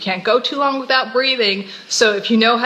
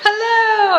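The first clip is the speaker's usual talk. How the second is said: laughing; 13 semitones higher, 4 dB louder than usual. Real, not acted. Greeting while laughing at start.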